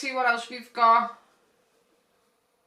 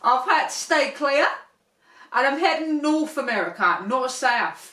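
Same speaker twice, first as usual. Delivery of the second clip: mock Australian shark; 3 semitones higher, 6 dB louder than usual. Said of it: "they're like".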